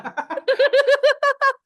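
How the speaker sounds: high, loud laughing burst; a big real laugh cracking up; loud burst of laughter. Real, not acted. Host cracking up at the punch joke, loud laugh.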